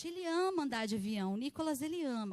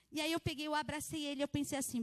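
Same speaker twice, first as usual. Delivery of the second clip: ordinary talk, nothing marked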